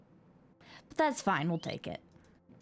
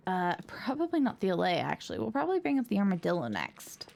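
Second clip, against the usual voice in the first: ordinary talk, nothing marked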